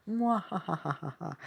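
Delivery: slow, gloating evil laugh; mad scientist. Acted. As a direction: cackle like a villain who won. Gloats about beating Food Theory.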